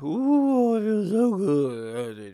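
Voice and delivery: slow, dreamy, high-pitched ooh; drawn-out floaty moan of pleasure; drugged-out bliss. Retold or imitated. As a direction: melt into a slow high-on-heroin purr. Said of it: "they talk like this".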